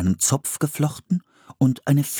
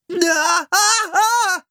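high, wailing yell of alarm; scream; 3 dB louder, much slower than usual. A narrator's reading. Boy caught by the ankle in a trap, high scream. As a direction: cry out in repeated high bursts. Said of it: "schrie Philipp".

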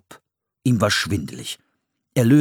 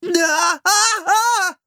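high yelping shouts of fright; startled cries; 3 dB louder, much slower than usual. A narrator's reading. Philipp caught by a snare, high frightened yelps. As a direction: cry out as you're yanked up. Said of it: "schrie Philipp".

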